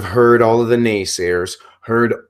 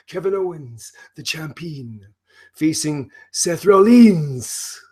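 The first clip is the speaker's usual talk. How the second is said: Broken Matt Hardy impression; 4 semitones higher than usual. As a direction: play the unhinged, grandiose wrestler announcing matches. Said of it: "the very complex character of Broken Matt Hardy".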